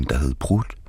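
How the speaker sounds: soft whisper on the last words; hushed, deadpan whisper; whispered punchline. A narrator's reading. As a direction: breathe the punchline out.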